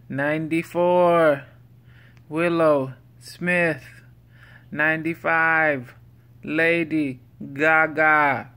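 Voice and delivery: loud, slow, drawn-out announcer read; odd countdown voice. Pretend. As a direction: call each name out slowly like a countdown host. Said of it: "I don't know why I'm talking this way".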